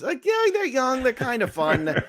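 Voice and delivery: high, teasing singsong; playful indulgent voice. Pretend. Joking about your sister's kids, playful high-pitched voice. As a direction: start very high and ease down, mock-fond.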